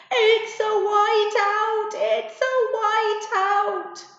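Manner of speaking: high, loud, slow panicked scream; screaming at top of lungs. Acted. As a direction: shriek it over and over in panic. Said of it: "at the top of her lungs".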